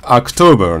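アクトーバー opening on 'ah', with English r; American October, first vowel near 'ah'; American pronunciation. Shown as a demonstration. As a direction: say アクトーバー as 'ahk-toh-ber'. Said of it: "実際は".

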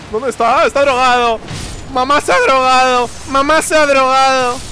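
grito agudo y fuerte, de broma; exclámalo como un escándalo; alarma exagerada. Real, not acted. Exclamación exagerada de asombro. (grito burlón de sorpresa)

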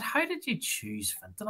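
high, quiet, puzzled question; curious question. Retold or imitated. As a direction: ask it genuinely puzzled. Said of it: "and I said".

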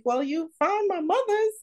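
very high, quick puzzled question; puzzled self-quote. Retold or imitated. She quotes her own question to the genealogist, very high and quick. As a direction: squeak it out in disbelief. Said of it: "I was like".

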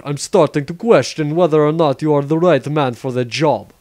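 ordinary delivery

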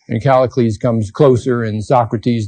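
normal talking voice

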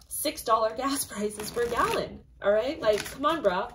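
ordinary delivery

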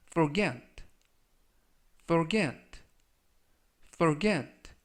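forget with get stressed; stress on the second syllable. Shown as a demonstration. Teacher modelling forget for the class, stress on get. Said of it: "강세는 뒷부분에 있는 알파벳 E의 강세".

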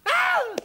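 very high, loud terrified screech. Acted. Mother screaming at three muddy figures. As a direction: scream at the sight of mud figures. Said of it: "let out a large screech".

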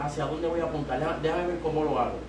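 ordinary talk, nothing marked

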